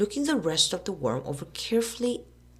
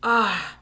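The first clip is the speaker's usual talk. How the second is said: loud mock groan of despair; theatrical ah; 4 semitones higher, 7 dB louder than usual. A narrator's reading. Tom lying down in the barn, mock groan. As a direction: flop down and groan. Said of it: "lay down on his back and said".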